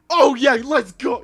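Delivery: loud, high, hyped-up shout. Shown as a demonstration. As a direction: pump it up like a hype streamer. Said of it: "hyped up voice".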